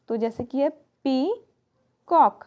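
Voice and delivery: peacock drawn out in two clear beats; syllable demo. Shown as a demonstration. Teacher showing children the syllables of peacock. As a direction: say peacock slowly, पी and कॉक separated. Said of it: "demonstrating syllables of peacock".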